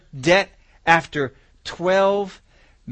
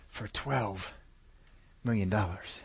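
hushed; 7 semitones lower, 13 dB quieter, slower than usual. Real, not acted. Sum said with hushed awe.

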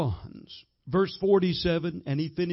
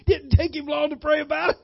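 laughing; 10 semitones higher, 7 dB louder than usual. Real, not acted. Preacher laughing at punchline.

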